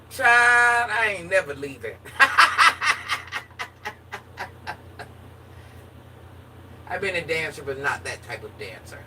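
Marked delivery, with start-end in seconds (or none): 0.1-2.0 exclamation. Real, not acted. Joking about never leaving. 2.0-6.8 burst of laughter. Real, not acted. Laughs at own joke.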